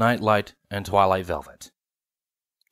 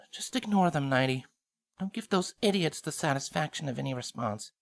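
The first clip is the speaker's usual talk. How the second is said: comforting; 5 semitones higher, 7 dB quieter than usual. Acted. Velvet comforting hug.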